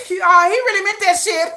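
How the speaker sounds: high, laughing disbelief; laughing through it. Retold or imitated. To her friends about Jin's joke, laughing. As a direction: giggle out the words, half crying with laughter. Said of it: "I'll be like".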